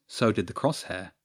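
plain reading voice

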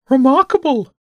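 high, loud pigeon voice; bishop bird. Acted. Bishop bird admires James's drawing, high bird voice.

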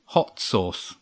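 understated, vindicated repeat; told-you-so. A narrator's reading. Laurie proved right to the others, told-you-so.

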